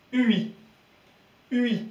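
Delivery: "U I" as u gliding to a clear i, high; Y read as I. Shown as a demonstration. Teacher reading the Y with the letter before, high clear i. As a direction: sound out "U I" clearly with a pure i ending. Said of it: "comme le son I".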